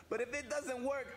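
usual speaking voice

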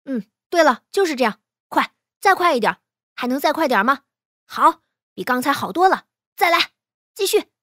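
loud sparring coach's calls; urging on. Acted. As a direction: push the pupil on mid-fight, loudly. Coach urging pupil mid sword fight.

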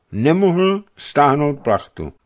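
put-on high, slow tearful boy; acted sobbing. A narrator's reading. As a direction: play a boy confessing through sobs. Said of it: "chlapec se rozeštkal". (hrané vzlykání, pomalu a vysoko)

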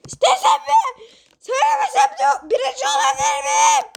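a piercing high yell of a little singer; loud high greeting. Acted. Pumpkin yells his greeting into the judge's ear, loud and high. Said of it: "başım şişti ya".

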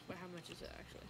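usual speaking voice

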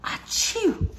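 high, breathy mock sneeze; hammed-up achoo sneeze; big sneeze. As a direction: blast out a cartoon sneeze.

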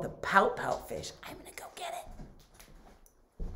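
hushed whisper of the title. Real, not acted.

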